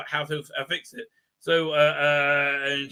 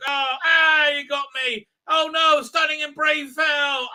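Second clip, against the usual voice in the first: high, loud hammed-up cries; mock distress; 9 semitones higher, 5 dB louder, slower than usual. Acting out the heroine shot down, high mock-distressed cries. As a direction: fake alarm at being hit.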